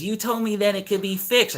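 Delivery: high mock insistence; imitating old lady. Old lady disputes neighbor over mower repair, mimicked high. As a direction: pitch it up, mimicking her protest. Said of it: "she goes".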